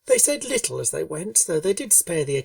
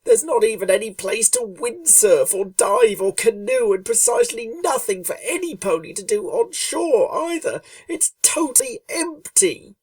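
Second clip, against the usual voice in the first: complaining Rainbow Dash; 7 semitones higher, 4 dB louder than usual.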